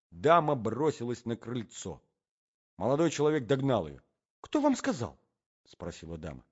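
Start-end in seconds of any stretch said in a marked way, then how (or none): 4.4-5.2 very high, alarmed question; alarmed lady. Acted. Lady questions the young man who caught her, alarmed.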